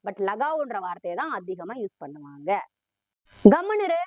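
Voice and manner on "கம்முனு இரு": loud, high scolding shout. Acted. Parent loudly scolding a crying child.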